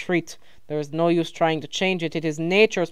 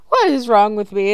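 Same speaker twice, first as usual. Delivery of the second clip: ordinary delivery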